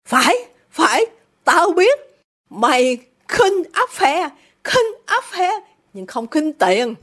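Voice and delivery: furious father dragging out his accusation; very angry. A narrator's reading. Father accuses daughter of despising business, very angry. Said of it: "Isidore giận dữ lắm".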